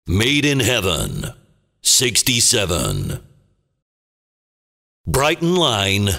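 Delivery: ordinary delivery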